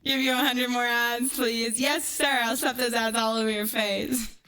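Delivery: ordinary talk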